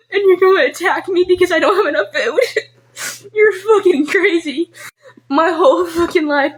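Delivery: tearful fury. Real, not acted. Teen confronts commenters attacking her over food, crying and furious. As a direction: hurl it at them while sobbing.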